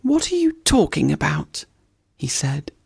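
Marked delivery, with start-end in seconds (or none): 0.0-1.6 much higher, indignant squirrel voice; huffy little squirrel; scolding squirrel. Acted. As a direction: snap at the woodpecker like a fussy squirrel. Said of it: "scolded him".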